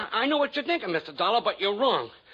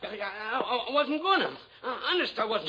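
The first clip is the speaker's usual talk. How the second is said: nervous stammer; 6 semitones higher than usual. Acted. Nervous ex-con caught at gunpoint.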